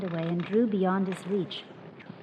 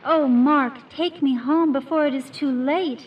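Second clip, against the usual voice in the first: louder, higher pleading in despair; despairing plea; 6 semitones higher, 7 dB louder than usual. A narrator's reading. Rose begs Mark to take her home, loud despairing plea. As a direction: beg to be taken home, voice rising in despair. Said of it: "saying in a tone of despair".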